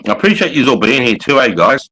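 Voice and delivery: usual speaking voice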